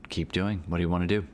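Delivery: normal talking voice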